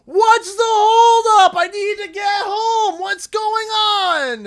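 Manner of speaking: loud, slow, high mock shout of stuck drivers; angry shouting. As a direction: yell it like an impatient driver stuck in the queue.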